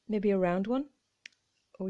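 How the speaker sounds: usual speaking voice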